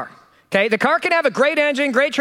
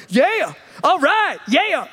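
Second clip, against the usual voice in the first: slow, drawn-out country approval; good old boy; 5 semitones higher, much slower than usual. Retold or imitated. Drivers trying to race him, slow good old boy cheer. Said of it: "everybody's like".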